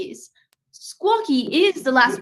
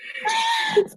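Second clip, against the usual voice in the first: high laugh. Acted. Detective laughs at bird joke.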